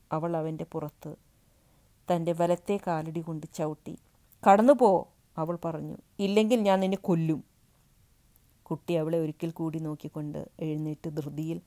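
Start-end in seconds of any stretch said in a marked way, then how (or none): 4.4-4.9 very loud, high scream to get out; woman kicking the boy out, screaming; screamed order. Acted. 6.3-7.4 fast, loud furious threat; woman threatening the boy, fast and furious; rushed threat. Acted.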